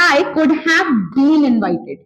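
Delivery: drawn out word by word; slow model sentence. Shown as a demonstration. Teacher slowly modelling the passive invite taunt. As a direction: dictate it slowly. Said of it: "तो आप बोलेंगे".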